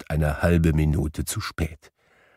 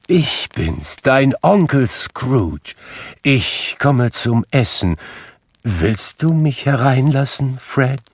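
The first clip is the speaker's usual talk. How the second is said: lightly voiced humble old Scrooge; 6 semitones higher, slower than usual. Scrooge at nephew's door.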